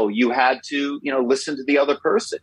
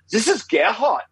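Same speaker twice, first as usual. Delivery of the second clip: higher-pitched put-on German accent; 8 semitones higher than usual. German office caller phoning American colleagues, in a put-on German accent. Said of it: "pretending to have a German accent".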